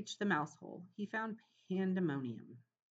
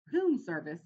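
louder outraged echo; exclaimed; 10 dB louder, slower than usual. A narrator's reading. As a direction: throw the words back at him. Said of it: "exclaimed his mother".

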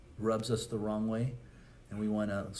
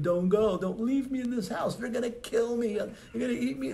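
much higher, mock-panicked kid wailing; hammed-up screaming child; 13 semitones higher, 4 dB louder than usual. Imitating a babysat kid screaming as mama leaves. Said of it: "crying, screaming".

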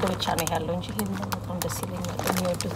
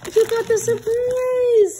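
excited; 6 dB louder, much slower than usual. Real, not acted. Opening a surprise box.

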